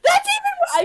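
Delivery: top-of-the-lungs high scream; screaming outrage. Retold or imitated. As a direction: scream it in furious disbelief. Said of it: "I was screaming at the top of my lungs".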